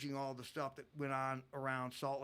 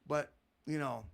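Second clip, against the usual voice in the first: ordinary delivery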